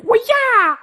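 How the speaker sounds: very high martial arts cry; hammed-up high battle cry; mock kung fu yell. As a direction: imitate a kung fu strike shout. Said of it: "did some kung fu like".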